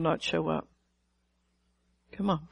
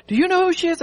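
higher, louder startled question; alarmed daughter; 9 semitones higher, 4 dB louder, faster than usual. Retold or imitated. Preacher retelling her daughter asking in alarm. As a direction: voice the daughter's shock.

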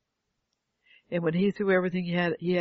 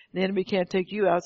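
normal talking voice

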